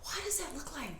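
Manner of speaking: slow, hushed, breathy disbelief; stunned hush. Retold or imitated. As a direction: barely voice the question, staring at the screen. Staring at ultrasound, bewildered.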